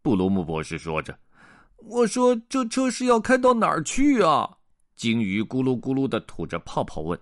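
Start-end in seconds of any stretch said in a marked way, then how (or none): 1.9-4.6 high-pitched questioning fish; much higher pet-fish voice; goldfish character. Acted. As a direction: pipe up high as a puzzled little fish. Goldfish character asks where they go.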